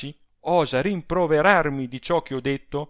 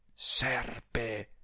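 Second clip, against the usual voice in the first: venomous insult; 9 dB quieter than usual. A narrator's reading. Adele curses her absent rival, venomous. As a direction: spit it out under the breath.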